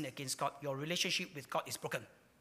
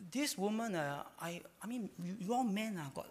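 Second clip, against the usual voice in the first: mock excuse; 2 semitones higher, 5 dB quieter than usual. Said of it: "He didn't say".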